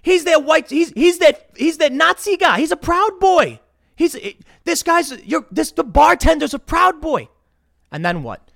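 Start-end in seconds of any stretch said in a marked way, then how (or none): 0.0-7.3 high, loud pointing accusation; mock accusing shout. Advising Elad to accuse the bartender, loud and high. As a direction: call him out loud across the bar. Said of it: "be like".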